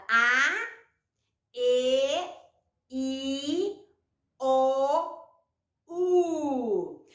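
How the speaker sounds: A, E, I, O, U each held long and apart; vowels sounded out. Shown as a demonstration. Teacher listing the five vowels for children, sounded out. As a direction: draw out each vowel from A to U separately. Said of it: "da ajuda das cinco vogais".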